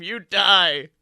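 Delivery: ordinary talk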